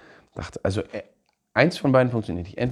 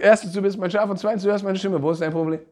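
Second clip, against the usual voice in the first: higher, quicker amused voice; voicing Jesus; 7 semitones higher, faster than usual. Jesus answering the doubting believer, amused and quicker. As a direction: toss off the obvious answer. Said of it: "Er sagt".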